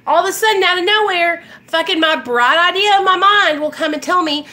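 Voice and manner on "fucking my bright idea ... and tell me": usual speaking voice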